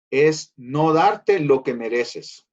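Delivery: slowly spelled out, each word weighed; teaching the meaning of mercy, slow and emphatic. Shown as a demonstration. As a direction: lay the definition down slowly. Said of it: "definition emphasized".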